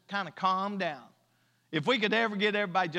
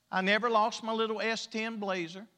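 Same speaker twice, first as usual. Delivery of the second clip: ordinary delivery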